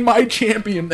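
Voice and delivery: a touch of pretend tearful pleading; crying Jean-Claude Van Damme. Podcaster repeats the star's plea to the fighter, mock crying. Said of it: "literally crying".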